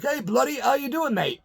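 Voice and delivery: high-pitched imitation of a Scottish greeting; mock Scottish accent, high and lively; Scottish brogue. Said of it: "in that total Scottish accent".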